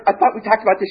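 high-pitched indignant complaint; put-on affronted lady; offended customer complaining. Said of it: "She goes up to the clerk".

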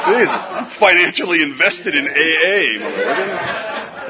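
loud, slow laughing yell; laughing shout. Real, not acted. Sharing at a meeting, punchline shouted slowly.

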